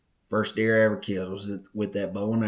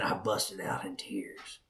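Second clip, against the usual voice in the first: emotional whisper; 4 semitones lower, 10 dB quieter than usual. Real, not acted. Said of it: "it makes me emotional".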